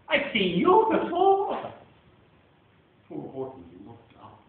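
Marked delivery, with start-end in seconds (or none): 0.1-1.7 sly bird voice. Acted. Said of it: "she gasped".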